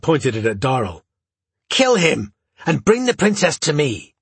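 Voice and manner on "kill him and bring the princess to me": shrill command. A narrator's reading. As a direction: shriek the order like a tyrant. Duke shouts his command.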